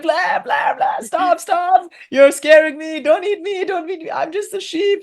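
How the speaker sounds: very high panicked pleading with shouted bleats; frightened bleating. Acted. Student lion begging the guru lion, terrified high pleading. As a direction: squeal it out, terrified of being eaten. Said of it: "the student lion said".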